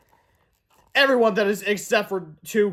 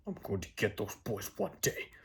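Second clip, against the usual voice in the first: much lower, much softer mean principal; menacing vow; 9 semitones lower, 14 dB quieter than usual. Acted. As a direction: growl the threat low and quiet. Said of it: "Mister Krupp vowed".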